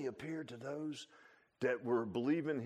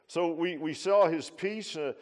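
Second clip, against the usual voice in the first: usual speaking voice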